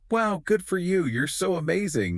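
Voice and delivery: high-pitched, mock-admiring congratulations; gushing praise. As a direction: gush like an impressed crowd of followers. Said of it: "everyone replies".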